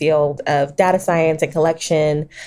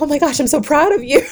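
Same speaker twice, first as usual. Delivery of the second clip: higher, gushing imitation of her proud husband; 10 semitones higher, faster than usual. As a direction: lightly play the husband beaming over her tears. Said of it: "he's like".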